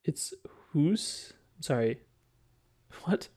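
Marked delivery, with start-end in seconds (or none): 0.7-1.3 "hoose" with an oo vowel, questioning rise; questioned pronunciation. Shown as a demonstration. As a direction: query house said as "hoose". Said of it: "that's how they say it".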